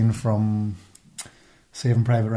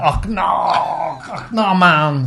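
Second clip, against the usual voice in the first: slow, drawn-out higher mock accent; Geddes impression; 5 semitones higher, much slower than usual. Imitating Geddes's voice for a friend, slow and drawn-out. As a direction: stretch it out in a comic accent. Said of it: "the way Geddes is doing the".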